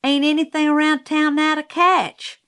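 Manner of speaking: protesting. A narrator's reading. Scout objecting to Cecil in the story, protesting. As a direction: object in a high, indignant child's voice. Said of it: "I protested".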